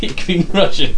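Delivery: put-on high, loud, laughing Russian accent; mock Russian accent. Host mimicking his co-host, laughing mock Russian accent. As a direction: echo a bad Russian accent through laughter. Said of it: "I can do the Russian accent".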